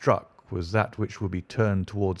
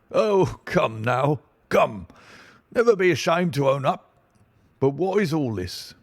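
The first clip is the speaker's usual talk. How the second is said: higher, louder, patronizing bluster; pompous inspector; 6 semitones higher, 4 dB louder than usual. Acted. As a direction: chide him like a smug superior.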